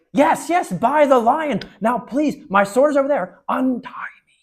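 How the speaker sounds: very high, loud, breathless begging; urgent plea. Acted. Prince urging the children to cut him loose, very high and loud. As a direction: blurt it out, desperate to be freed.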